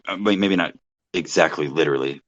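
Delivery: ordinary talk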